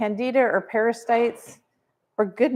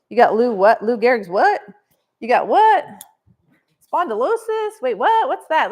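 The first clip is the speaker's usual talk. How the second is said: hammed-up surprised what; mock bafflement; 3 semitones higher, 6 dB louder than usual. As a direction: play the lost advocate hearing big words. Mock baffled reaction to diagnoses.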